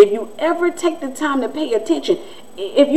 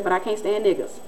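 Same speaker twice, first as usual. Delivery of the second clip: softer retold aside; quiet, imitated admission; confided admission; 3 semitones lower, 7 dB quieter than usual. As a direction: drop the volume as if confiding. Retells neighbor's confided remark.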